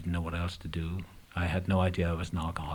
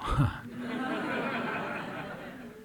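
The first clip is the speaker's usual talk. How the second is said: short airy laugh of relief; soft breathy laugh; 4 dB quieter than usual. Real, not acted. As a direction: let out a quiet breathy laugh. Laughs after mother's recovery.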